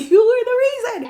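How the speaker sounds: giggling through the words; laughing high. Real, not acted. Giggling as the sibling joins the teasing.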